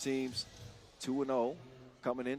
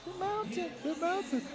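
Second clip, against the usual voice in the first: squeaky high mountain call; high-pitched voice. Pretend. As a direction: jokingly pitch it way up. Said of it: "I should have said".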